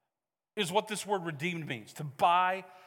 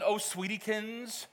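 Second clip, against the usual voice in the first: soft, drawn-out cooing pet name; sweet mom voice; 2 semitones higher, 5 dB quieter, slower than usual. Retold or imitated. Mom to a child not in trouble, sweetly cooing. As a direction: coo it sweetly, stretching it out. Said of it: "It wasn't".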